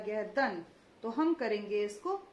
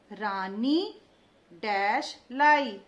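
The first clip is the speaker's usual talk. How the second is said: slow, louder, every syllable clear; slow dictation; 3 semitones lower, 5 dB louder, much slower than usual. Shown as a demonstration. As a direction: dictate it slowly to the class. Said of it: "देखते जाइए मैं कैसे उच्चारण कर रही हूँ".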